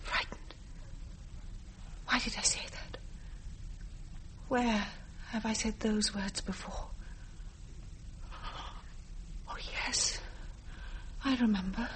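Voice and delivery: soft, hushed, wondering; whispered recollection. Acted. Girl recalling the past to herself, whispered. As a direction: murmur it as memory returns.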